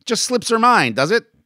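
loud, high-pitched jeer; angry taunt. Acted. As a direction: taunt him loudly. Taunt in an acted quarrel.

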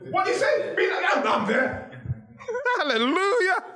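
high, laughing mock disbelief. Preacher laughing off the claim that some can't come there, high and incredulous. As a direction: scoff through laughter at the idea of being barred. Preacher mocks a ban on postcodes.

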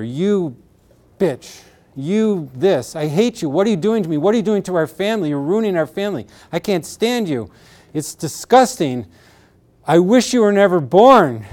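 retold rage, climbing in pitch and force; acted-out anger. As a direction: act out a furious parent's tirade, building to the last line. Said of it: "what does that anger say to your daughter".

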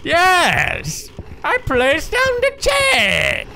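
very high, drawn-out villain voice; hammy high-pitched movie villain impression; Bane. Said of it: "I'm the worst at being Bane".

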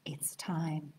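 hushed, suspenseful whisper; dramatic whisper. A narrator's reading. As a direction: whisper the big moment. Dramatic reveal in story reading.

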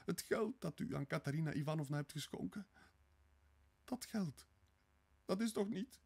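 high, horrified, broken-off question; appalled, halting realisation; struck by dread. A narrator's reading. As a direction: ask it high, as a terrible thought hits. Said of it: "getroffen door een vreselijke gedachte".

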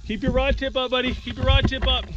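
high, urgent coaching call; fighting a fish. Real, not acted.

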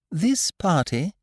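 high, slow, drawn-out announcement; Christopher Robin's boyish speech-making. A narrator's reading. Christopher Robin addressing the party guests, high announcing voice. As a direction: open the speech high and deliberate.

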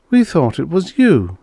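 high-pitched, pointed reply; female captive's voice. A narrator's reading. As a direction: voice her high and insistent. Captive accuses the other prisoner.

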